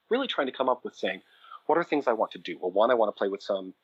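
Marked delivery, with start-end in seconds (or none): none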